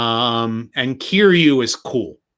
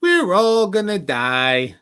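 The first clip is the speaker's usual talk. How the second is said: character impression; 7 semitones higher, slower than usual. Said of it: "it's kinda hard to do his".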